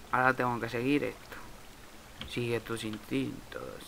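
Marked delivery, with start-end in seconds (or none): none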